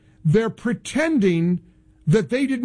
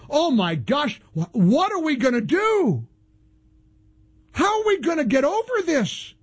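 mock panic; 5 semitones higher than usual. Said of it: "I was being sarcastic".

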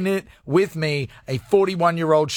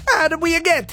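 lightly put-on Mario accent, pitched up at the start; 10 semitones higher than usual. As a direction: say it with a jokey Mario-style Italian accent. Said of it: "in a Mario accent".

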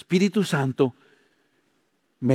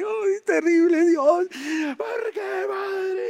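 hammed-up wail, climbing much higher; mock wailing cry; much slower than usual. Mocking a suffering believer crying out to God, high hammed-up wail. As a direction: wail to God in exaggerated despair. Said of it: "lo viera como llora y habla y clama".

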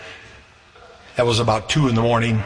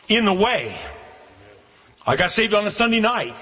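raised, ringing preacher's voice, pitched well higher; preaching; 7 semitones higher than usual. Acted. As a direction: lift it up and proclaim to the congregation.

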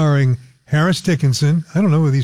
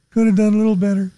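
unimpressed, fast; 6 semitones higher, faster than usual. Real, not acted. Judging makeup, repeated phrase.

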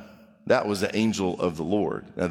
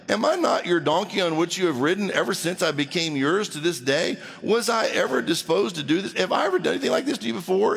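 higher-pitched pleading questions, climbing at the end; protesting donkey; 6 semitones higher, faster than usual. Acted. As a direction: plead your case as the wronged donkey. Acts donkey protesting to Balaam.

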